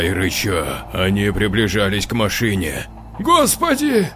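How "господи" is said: high, stammered cry; stammering. Acted. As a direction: stutter out the cry in fright. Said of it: "заикнулся Егор".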